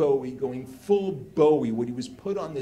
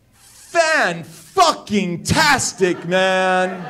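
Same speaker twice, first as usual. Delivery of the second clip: slow, much higher and louder "Fan-fucking-tastic"; ecstatic shout; 5 semitones higher, 12 dB louder, much slower than usual. Retold or imitated. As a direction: bellow "Fan-fucking-tastic" in pure delight, laughing at the end. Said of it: "and he goes".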